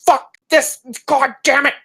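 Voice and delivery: high-pitched outraged scream in character; acted screaming tantrum, each word thrown out. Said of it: "You see [name] just screaming".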